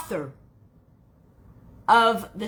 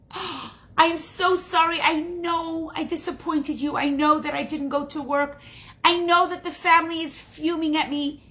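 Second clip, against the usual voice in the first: distraught apology; 9 semitones higher, 4 dB louder than usual. Acted. Rose apologizes to her cousin for missing work, distraught. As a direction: plead for forgiveness, building to a loud, firm declaration.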